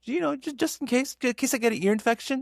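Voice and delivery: high-pitched, hedging excuse; imitated mother-in-law. Mother-in-law explaining her antibiotic stash, high imitation.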